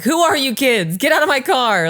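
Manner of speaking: high, loud fake panic at the kids; hammed-up mock alarm. Mom playing up fear of her kids in the car. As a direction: ham up a startled yell at the back seat. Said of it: "Like, playing it up".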